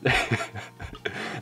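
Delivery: breathy, airless laugh bursting out; real burst of laughter. Real, not acted. Laughing at the cop's plywood suggestion, airless laugh. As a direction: crack up at the cop's answer. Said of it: "Now I'm laughing my ass off".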